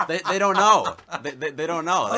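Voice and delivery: loud, high laughing outburst; a loud, high cry of disbelief. Real, not acted. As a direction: nearly shout they don't know.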